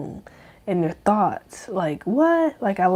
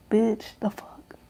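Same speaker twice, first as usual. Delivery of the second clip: whispered; 3 semitones higher, 5 dB quieter, slower than usual. Real, not acted. Aside to himself mid rant.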